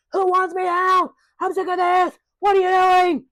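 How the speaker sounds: high, loud hollered outburst; mock shouting. Imitating Shaq popping off at everyone, mock shouting. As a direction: yell it out high and drawn-out, imitating him.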